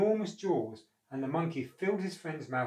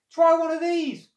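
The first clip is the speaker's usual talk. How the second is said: high, loud friendly call; monkey calling down; 12 dB louder than usual. A narrator's reading. Monkey offering fruit to a crocodile below, calling out. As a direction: call it out from up in the tree. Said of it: "called the monkey".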